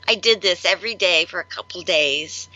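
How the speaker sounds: ordinary talk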